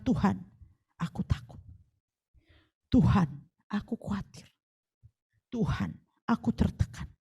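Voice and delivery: hushed, pleading short prayers; quiet pleading prayer; soft prayer. Shown as a demonstration. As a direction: murmur it to God softly.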